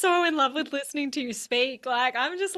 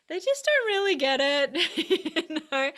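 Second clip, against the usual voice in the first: laughing; 6 semitones higher than usual. Real, not acted. Amused about unsupportive families.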